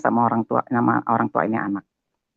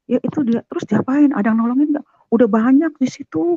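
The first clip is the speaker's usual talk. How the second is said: high-pitched, hurried retold exchange; urgent neighbour talk; 12 semitones higher than usual. Neighbours discussing the stricken child, urgent retold talk. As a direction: imitate worried neighbours trading news.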